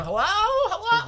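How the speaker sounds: very high, slow laughing hellos; mock him calling out timidly; squeaky drawn-out mock hello. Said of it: "just walking around going".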